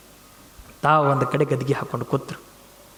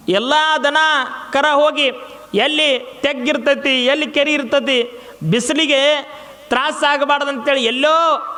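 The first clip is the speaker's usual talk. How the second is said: raised storytelling preach; 8 semitones higher than usual. Acted. Preacher tells drought story.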